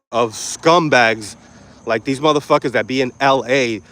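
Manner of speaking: normal talking voice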